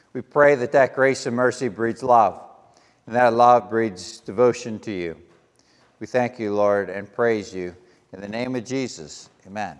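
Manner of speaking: hushed, reverent ending; closing prayer. Real, not acted. Closes the prayer.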